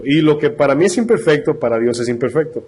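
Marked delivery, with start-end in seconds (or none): none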